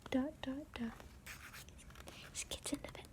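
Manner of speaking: plain reading voice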